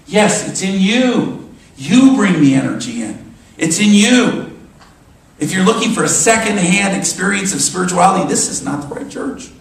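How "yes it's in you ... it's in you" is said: loud, emphatic preacher's proclamation; raised, forceful pulpit voice; rousing exhortation. Real, not acted. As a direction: drive it home to the congregation, punching "you". Preacher exhorts congregation directly.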